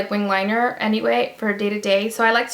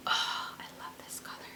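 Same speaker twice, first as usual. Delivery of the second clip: whispered, breathless delight over a favourite liner; gushing whisper; 17 dB quieter than usual. Real, not acted. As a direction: hush it like a secret you love.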